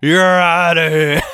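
slow loud umpire shout; umpire call. Pretend. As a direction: bellow it like a referee. Mimics ref ejecting, shouts.

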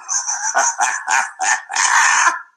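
an airy, voiceless chuckle; breathy laugh. Real, not acted. Laughing to himself after the wife punchline.

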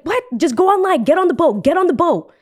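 high-pitched, insistent ordering; urgent cruise director. Retold or imitated. Cruise director sending stranded passengers aboard, urgently insistent. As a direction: imitate an official hurrying them along. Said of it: "And she's like".

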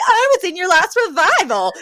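high, gushing camp fan; campy. Retold or imitated. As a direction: pitch it way up, campily thrilled. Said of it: "he starts extremely campily".

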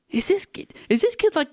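high-pitched mock disbelief; baffled teacher. Teacher doubting the boy's sense, mock bafflement. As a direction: question it in high, incredulous bafflement.

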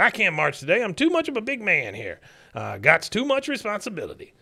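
high-pitched cartoon excuse-making; stubborn father impression. Father making excuses to his son, mock stubborn. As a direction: push the voice up high and act self-important. Imitated father refusing son's request.

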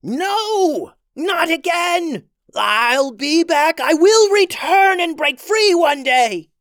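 high, loud, slow villain shriek; angry shriek. Acted. Witch Queen being pulled into the stone, furious high shriek. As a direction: howl defiance as she is dragged away. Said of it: "She shrieked in anger".

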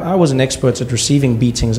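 usual speaking voice

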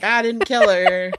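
drawn-out, mock-carefree denial; slow gloating sing-out, as if skipping home; skipping-away glee. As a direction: playfully crow it on your way out. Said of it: "I'm skipping out all the way".

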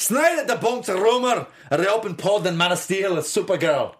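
amateur Scottish burr; Scottish accent. Pretend. Cohost reads copy in front of her in mock Scottish. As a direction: read it out in an untried Scottish accent. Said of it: "I've never done Scottish before".

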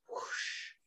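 soft, unvoiced whoosh; whispery whoosh imitating a wave through the body; imitated whoosh. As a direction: let out an airy rush of sound. Imitating bodily release as sound.

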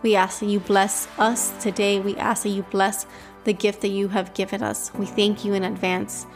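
usual speaking voice

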